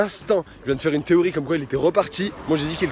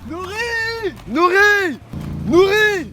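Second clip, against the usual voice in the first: loud high shout across the lake; calling out; 4 dB louder, much slower than usual. Real, not acted. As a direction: yell a name to someone far away. Appelle un ami perdu. (appel crié au loin)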